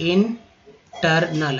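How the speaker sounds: Internal drawn out very slowly, in-ter-nal; very slow drawn word. Shown as a demonstration. Teacher shows the टर in internal, slowly. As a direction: take internal apart slowly. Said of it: "ई आर जो है इसे र".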